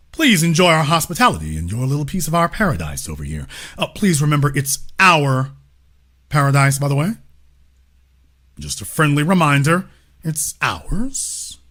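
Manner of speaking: low, soft, pointed courtesy; mock-polite host. Host reminding a foreign guest whose paradise it is, mock-polite. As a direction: play the gracious host with a quiet warning.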